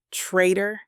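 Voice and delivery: ordinary delivery, nothing marked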